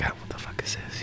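hushed, amazed whisper; a kid discovering a new toy; whispered wonder. Retold or imitated. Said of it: "And he's like".

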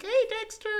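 very high squeaky cartoon voice. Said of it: "he's like".

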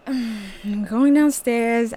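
resigned sigh into the words; reluctant, slow and heavy. Retold or imitated. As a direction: drag the words out, unwilling.

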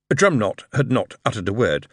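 usual speaking voice

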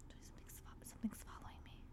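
barely audible, spooked whisper; real uneasy whisper; fearful whisper. Real, not acted. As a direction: confide it in a breathy whisper. Confiding fear, whispered.

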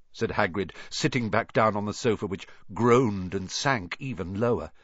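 ordinary delivery, nothing marked

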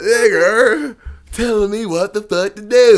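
high, slow, loud mock outrage; loud imitation. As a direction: bellow it like a furious man, drawn out. Mimicking angry man from video.

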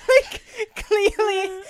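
high, loud, slow words through real laughter; helpless giggling; laughing fit. Real, not acted.

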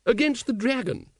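high ladylike voice, quite unruffled; high-voiced lady, not bothered at all; unconcerned. A narrator's reading. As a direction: carry on blithely, pitched well up. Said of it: "quite unconcerned".

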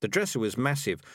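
ordinary talk, nothing marked

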